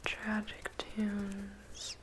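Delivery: ordinary delivery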